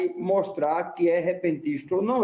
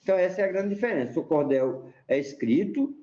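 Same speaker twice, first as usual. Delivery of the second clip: ordinary talk, nothing marked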